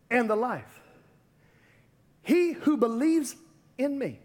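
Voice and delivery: scripture declaimed in short phrases with long pauses; weighty, halting proclamation; solemn declamation. Acted. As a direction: let each phrase hang before the next. Preacher solemnly declaims Jesus' words.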